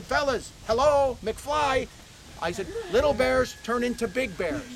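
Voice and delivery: like talking to someone dim; exasperated mock retort. Telling officers that bears grow, in slow exasperated disbelief.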